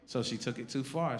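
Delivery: usual speaking voice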